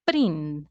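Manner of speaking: "prin" held and leaned on, pitched low; stressed syllable alone. Shown as a demonstration. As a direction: isolate "prin" as the strong syllable. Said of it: "The strongest part of the word is".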